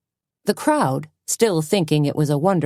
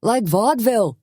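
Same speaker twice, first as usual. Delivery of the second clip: delighted cry; 6 semitones higher than usual. A narrator's reading. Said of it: "cried a voice".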